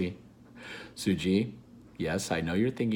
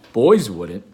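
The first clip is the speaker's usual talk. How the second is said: loud grinning BFG; 11 dB louder than usual. Acted.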